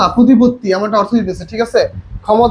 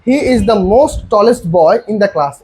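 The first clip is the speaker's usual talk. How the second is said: slow read-out; much slower than usual. Shown as a demonstration. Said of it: "দেখো".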